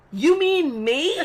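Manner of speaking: high, loud, slow you mean me; incredulous. Retold or imitated. Staring at the drunk guy, loud high incredulous question. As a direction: drag out the disbelief. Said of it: "I'm like".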